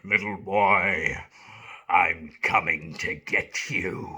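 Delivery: put-on old London menace. Shown as a demonstration. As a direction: creep up on the boy like a cockney villain. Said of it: "old sort of London accent's a bit like that".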